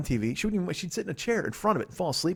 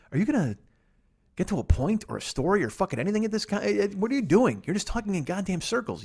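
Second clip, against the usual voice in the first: higher-pitched exasperated questioning; mock a fed-up producer picking at you; exasperated heckle; 7 semitones higher than usual. Imagined producer challenging the host on his show, exasperated.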